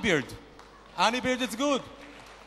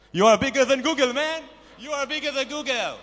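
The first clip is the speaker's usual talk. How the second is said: put-on loud, high hype yell in an Israeli accent; excited shout; 9 semitones higher, 6 dB louder than usual. Shark investor hypes the Boxy founder, loud and high. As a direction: pump him up like a crazed fan.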